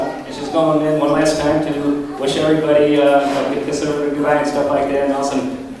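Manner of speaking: normal talking voice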